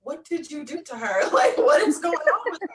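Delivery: retold husband's alarmed question, breaking into a laugh; high, loud mock-alarmed husband. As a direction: play him puzzled, then laughing. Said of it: "he was like".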